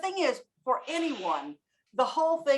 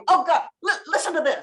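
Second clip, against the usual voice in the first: excited retelling; 5 semitones higher, 4 dB louder than usual. Retold or imitated. Learner excitedly retelling to somebody.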